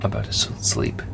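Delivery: sleepy near-whisper, voice fading out; exhausted. Real, not acted. Exhausted vlogger signing off before sleep, drowsy whisper. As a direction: whisper it as if about to pass out. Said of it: "I'm exhausted".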